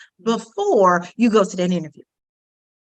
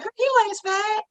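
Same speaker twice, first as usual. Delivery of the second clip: high-pitched mock reassurance; girlfriend protesting; 12 semitones higher than usual. Girlfriend to her friend who feels fat, high protesting. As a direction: pitch it up in playful disbelief.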